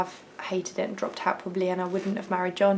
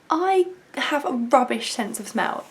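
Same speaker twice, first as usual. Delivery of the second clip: usual speaking voice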